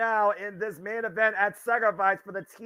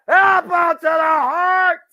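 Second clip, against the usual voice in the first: high, loud commentator yell; shouting; 12 semitones higher, 9 dB louder, slower than usual. Real, not acted. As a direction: call the big move at full voice. Live call of finishing move.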